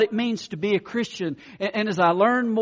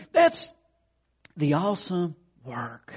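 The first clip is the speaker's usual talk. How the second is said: usual speaking voice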